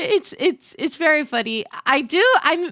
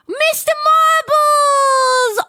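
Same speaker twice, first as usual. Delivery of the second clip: high, loud, drawn-out cry of delight; squealing slowly over a cute name; delighted exclamation; 14 semitones higher, 5 dB louder, much slower than usual. Real, not acted. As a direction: call out the pet's name, stretched and gleeful. Delighted at seeing the pet's name.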